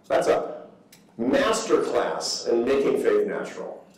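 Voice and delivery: ordinary talk, nothing marked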